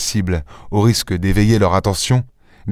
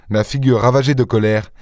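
ordinary delivery, nothing marked

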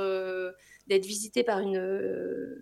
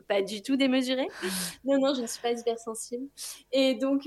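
usual speaking voice

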